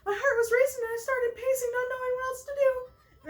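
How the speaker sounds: very high, nervous character voice; lovestruck Pelly character, panicky. Acted. Player reading Pelly's panic at meeting Pete, high and nervous. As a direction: pitch it way up, heart racing.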